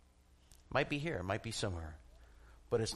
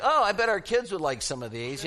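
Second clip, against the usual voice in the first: mumbling aloud; 5 semitones higher, 7 dB louder than usual. Pretend. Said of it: "I kinda mumble to myself out loud".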